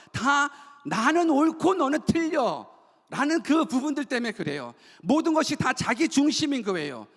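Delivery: high, driving sermon delivery; impassioned preaching. Real, not acted. Pastor warning the congregation about self-centred living, impassioned preaching. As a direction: preach hard, stretching out the key words.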